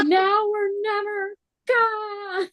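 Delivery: acted battle cry. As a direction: stretch it out high, bracing for the plunge. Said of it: "It was like".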